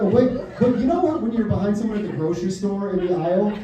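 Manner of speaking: usual speaking voice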